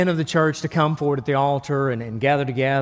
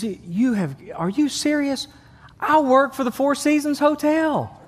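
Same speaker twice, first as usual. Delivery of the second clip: disbelieving new father; 9 semitones higher than usual. Acted. Third father reacts.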